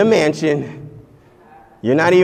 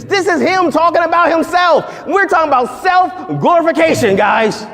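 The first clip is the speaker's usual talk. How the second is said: excited shout; 12 semitones higher than usual. Real, not acted. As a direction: shout it out to the church. Preacher exclaiming to congregation.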